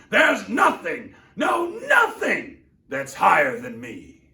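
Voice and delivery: high, loud, haughty shouting; arrogant tirade. Acted. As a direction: tower over him with every word. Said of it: "howled the mighty King Yertle".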